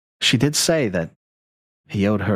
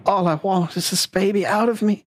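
much higher-pitched acted plea; acted labour plea; 11 semitones higher than usual. Labouring Tessa answers Hattie, high acted plea. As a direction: voice a labouring mother, pitched well up.

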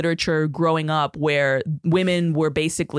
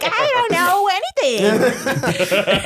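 very high, airy ditzy voice; dumb girl act; 12 semitones higher, 3 dB louder, much slower than usual. Retold or imitated. Teen playing dumb to the dealer, high and ditzy. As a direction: play the clueless girl, pitched way up. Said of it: "just be like".

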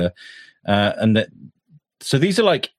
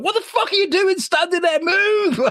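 yelling at the screen. Retold or imitated. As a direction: shout it at the TV, pitched way up. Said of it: "the whole time I was like".

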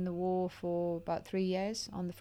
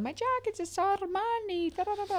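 Italian date on the phone; 12 semitones higher than usual. Retold or imitated. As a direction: whine about the jacket like the posh Italian. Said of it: "he rang me going".

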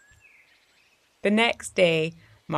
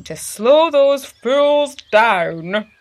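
much louder, higher, slow hammed-up grumble; dad ranting; 7 semitones higher, 10 dB louder, much slower than usual. Dad ranting to Marty about fast drivers, hammed-up indignant. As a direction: stretch it out like a fed-up dad.

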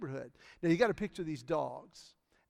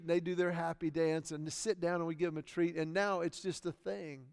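usual speaking voice

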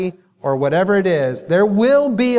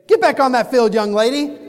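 stern parent; 6 semitones higher than usual. Retold or imitated. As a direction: snap the kid back to the game. Parents stand up, order daughter.

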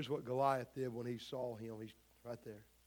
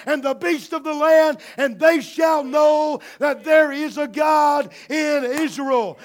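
David defying Goliath; 11 semitones higher, 15 dB louder than usual. Retold or imitated. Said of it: "And then he says".

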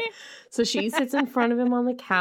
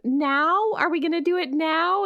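high, mock-eager heroine; Suki; 8 semitones higher than usual. Suki questioning Bill before they start, high mock-eager voice. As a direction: ask it high, as the naive heroine.

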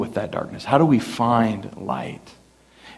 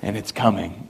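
low, hushed and ominous; spooky teasing voice; 5 semitones lower than usual. Retold or imitated. Dad teasing his scared younger brother, low and ominous. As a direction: tease him like a ghost story. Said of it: "he'd be like".

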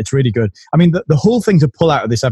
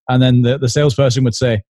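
ordinary delivery

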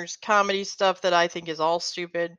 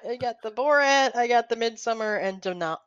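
normal talking voice

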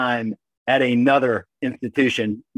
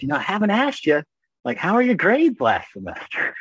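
mom voice; 8 semitones higher than usual. Retold or imitated.

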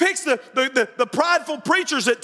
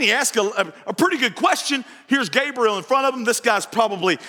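ordinary talk

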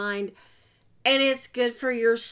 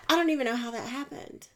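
normal talking voice

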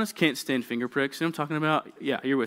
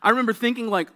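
ordinary talk

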